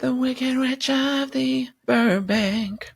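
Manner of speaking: very high, slow cackling witch voice; hammed-up falsetto witch announcement. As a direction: drawl it out like the Wicked Witch. Joking as Wicked witch.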